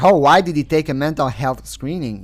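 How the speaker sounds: ordinary talk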